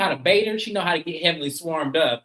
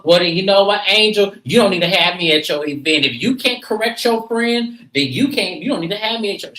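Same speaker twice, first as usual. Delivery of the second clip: loud, raised retort; Simone snapping back; 4 semitones higher, 5 dB louder than usual. Retold or imitated. Simone snapping back at Angel, loud. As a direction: throw it back at her, louder. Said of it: "She goes".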